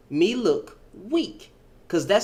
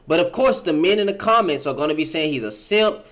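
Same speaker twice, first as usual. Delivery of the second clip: usual speaking voice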